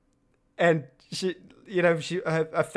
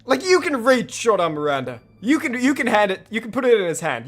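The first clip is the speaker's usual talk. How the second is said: exasperated scolding; 6 semitones higher, 5 dB louder than usual. Real, not acted.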